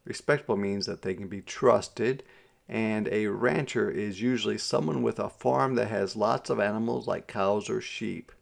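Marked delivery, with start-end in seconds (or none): none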